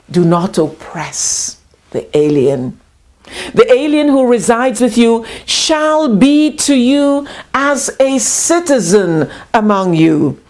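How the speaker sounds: usual speaking voice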